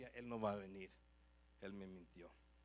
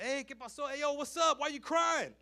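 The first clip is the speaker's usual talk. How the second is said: high, loud street friends calling out; 11 semitones higher, 13 dB louder than usual. Retold or imitated. Boys on the street call to a crying friend, loud and high. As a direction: yell over like a buddy on the corner. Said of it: "caminando y like".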